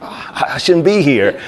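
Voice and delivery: slow, high and loud, puffing; re-enacted labored breathing; acted breathlessness. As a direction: gasp it out as if winded. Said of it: "I'm breathing hard at the time".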